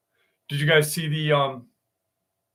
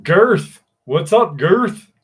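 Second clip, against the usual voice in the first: loud, high, punchy hello; called-out greeting; 5 semitones higher, 9 dB louder, much slower than usual. Real, not acted. Streamer greeting a viewer by nickname, calling out. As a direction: bellow the nickname, pitch jumping up.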